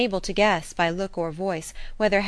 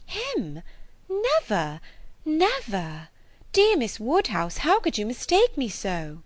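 high, pleading protest; distressed; 6 semitones higher, slower than usual. Acted. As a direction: cry out in dismay and hurt. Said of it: "turning away distressed".

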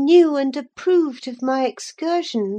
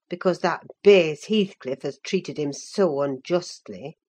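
put-on much lower voice, earnest older servant reproving; reproachful housekeeper; 7 semitones lower than usual. A narrator's reading. Servant reproves Catherine for sneering at her cousin, earnest reproach. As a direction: chide earnestly in a low, measured housekeeper's voice.

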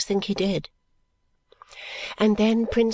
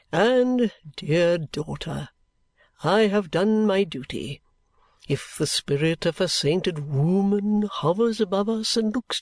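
slow, grandiose, swelling oratory; theatrical patriarch bestowing his sacrifice; pompous old father; 4 semitones lower, slower than usual. A narrator's reading. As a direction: draw out every word with self-important solemnity.

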